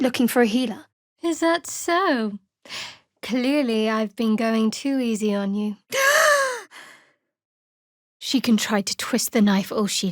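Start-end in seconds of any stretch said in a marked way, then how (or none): 5.8-6.6 high startled gasp; sharp gasp. Acted. Caught off guard by a sudden blow, sharp gasp. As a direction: gasp as if struck by surprise.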